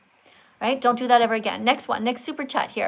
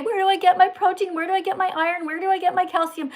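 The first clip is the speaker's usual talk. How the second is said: mock questioner; 6 semitones higher than usual. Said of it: "So nobody can say".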